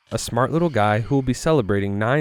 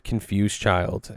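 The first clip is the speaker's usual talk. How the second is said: ordinary delivery, nothing marked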